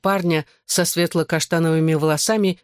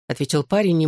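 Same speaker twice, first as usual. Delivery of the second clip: usual speaking voice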